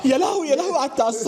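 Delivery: shocked. Acted. Fake shocked reaction to gift.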